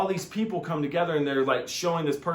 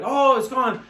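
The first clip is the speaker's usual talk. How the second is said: relieved person; 12 semitones higher, 7 dB louder than usual. Retold or imitated.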